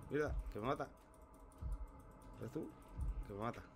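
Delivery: alarmed cry while fleeing; being chased. Real, not acted. Perseguido, a punto de morir. (perseguido y con pánico)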